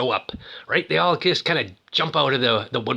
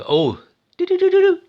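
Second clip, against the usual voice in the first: high, loud imitated beeping; tracker beep noise; 6 dB louder than usual. Logan's tracker device sounds as he searches, high loud beeping. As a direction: beep like a little tracker locking on.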